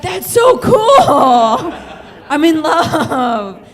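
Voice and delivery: high, loud, slowed squeal with laughter; shrieking, laughing, besotted gush; giddy swoon. Retold or imitated. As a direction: squeal it like a lovestruck teen. Swooning over her crush.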